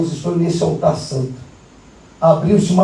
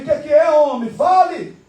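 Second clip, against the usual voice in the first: exasperated demand; 6 semitones higher than usual. Retold or imitated. Pastor demands answer in quarrel.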